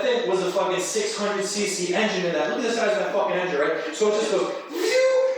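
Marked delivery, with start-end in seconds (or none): 4.7-5.4 high whistling whoosh of the cart taking off; a quick high phew like something launching; mock zoom sound; whoosh noise.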